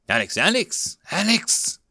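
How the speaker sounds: calm to panicked, the last Alex high and sharp; rising panic. Acted. Ricky calling wounded Alex, panic building. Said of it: "Ricky's voice became more panicked with every word".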